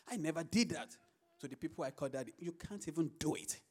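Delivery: ordinary talk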